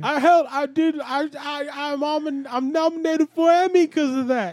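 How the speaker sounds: high, loud, excitable Tracy Morgan impression; a high, loud Tracy Morgan bit. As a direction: pitch it way up and push it out like Tracy Morgan. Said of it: "'cause I'm Tracy Morgan, y'all".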